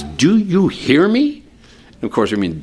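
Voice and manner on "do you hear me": put-on a parent slowing down to scold a child, pitched higher; exasperated parent checking the kid is listening. To a child who isn't listening, a slow, higher exasperated parent voice. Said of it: "We say to our kids".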